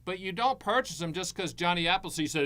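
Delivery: ordinary talk, nothing marked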